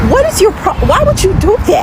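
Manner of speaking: high, shocked demand; indignant. Retold or imitated. To the laughing couple, shocked and high. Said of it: "And I'm like".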